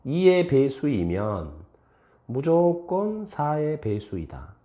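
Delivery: deliberate read-aloud, pausing before a long 무조건; model reading with 무조건. Shown as a demonstration. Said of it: "다시 한번 읽어볼까?".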